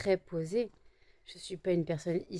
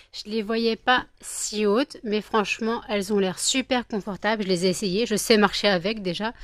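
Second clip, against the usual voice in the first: louder, gushing about the new shoes; delighted unboxing, louder; excited; 7 dB louder than usual. Real, not acted. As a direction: gush like a thrilled shopper.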